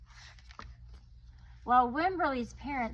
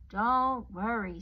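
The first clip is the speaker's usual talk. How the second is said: comforting father; 7 dB louder, slower than usual. Acted. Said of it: "said her father".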